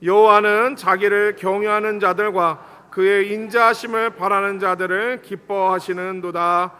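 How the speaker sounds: loud, measured congregational reading; scripture recitation. Real, not acted. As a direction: lead the verse out loud, drawing out the ending. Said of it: "말씀 같이 한번 읽겠습니다".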